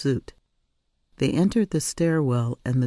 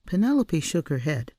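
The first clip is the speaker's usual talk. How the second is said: ordinary delivery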